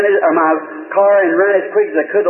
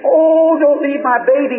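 retold hollering; 6 semitones higher, slower than usual. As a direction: imitate a frantic mother calling for her baby, pitched up. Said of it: "she kept hollering".

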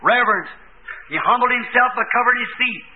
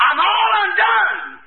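cried out; 8 semitones higher, slower than usual. Retold or imitated. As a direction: stretch it out in a wail. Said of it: "He cried out".

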